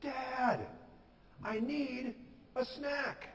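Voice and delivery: put-on high, drawn-out kid's holler; acted shout. Hungry boy in bed calling his dad, shouting. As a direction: holler for Dad as if from another room. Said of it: "he shouted".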